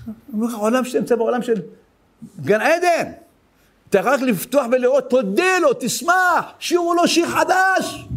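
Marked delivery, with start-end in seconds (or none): 3.9-8.1 higher, shouting call to rejoice and sing; preaching at full force. Acted. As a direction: rouse the congregation to joy. (הטפה נלהבת בקול רם ומתרומם)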